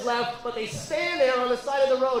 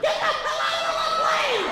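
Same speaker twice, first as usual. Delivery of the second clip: put-on much higher shrieking old lady, laughing through it; old woman yelling. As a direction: screech it like a furious granny driver. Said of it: "seventy-year-old woman in a Nissan".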